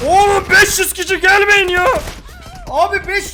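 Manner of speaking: high, loud outraged shouts; exasperated yelling. Real, not acted. Streamer swarmed by players, loud exasperated yell. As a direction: yell at the crowd of attackers.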